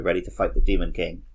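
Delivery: ordinary delivery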